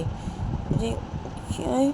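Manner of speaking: put-on high, pleading little girl's voice; a hurt child begging her papa; little daughter. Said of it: "बोलती है कि". (बेटी सोनम पापा से ऊँची, मिन्नत भरी आवाज़ में पूछती है)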